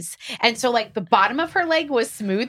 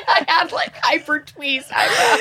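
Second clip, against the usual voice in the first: laughing through the confession, higher and louder; 7 semitones higher, 4 dB louder than usual. Real, not acted. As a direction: giggle while admitting the mistake. Laughing at own past mistake.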